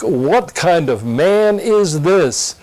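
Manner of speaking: ordinary talk, nothing marked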